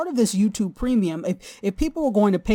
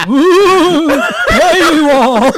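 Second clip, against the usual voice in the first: a hammed-up spooky ooh, much louder and higher; mock spooky dread; 9 semitones higher, 12 dB louder, much slower than usual. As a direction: play scared of the paywall for laughs. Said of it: "Which is very scary to Tachi".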